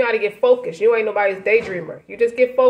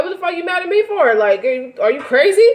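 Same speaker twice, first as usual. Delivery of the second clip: fast, higher put-on outrage; mock angry retort; 6 semitones higher than usual. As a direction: toss back the accusation in a quick huff. Acts angry retort to accuser.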